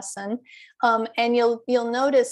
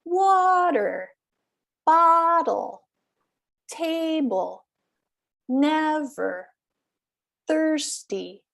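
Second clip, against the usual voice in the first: WA-ter, BOT-tle, TA-ble, NEV-er, THIRS-ty, first syllable high and long; exaggerated first-syllable stress; 5 semitones higher, slower than usual. Shown as a demonstration. Teacher modelling column two, water to thirsty with high first syllable. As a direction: stretch the first syllable of water, bottle, table, never, thirsty, drop the second. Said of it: "that's a little exaggerated".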